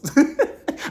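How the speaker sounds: a sudden high laugh; real amused laugh; high burst of laughter. Real, not acted. Laughs at her own joke.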